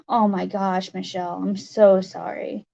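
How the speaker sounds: ordinary delivery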